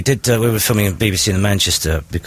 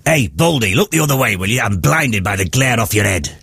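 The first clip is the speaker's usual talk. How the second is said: Gene Hunt voice; 3 semitones higher than usual. Pretend. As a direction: throw the insult like a tough old-school detective. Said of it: "I'd love to hear Gene say".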